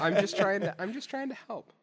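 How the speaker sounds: high-pitched, laughing self-defense; laughing protest. Real, not acted. Critic defends himself to teasing co-hosts, laughing protest. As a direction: protest through laughter, voice riding up.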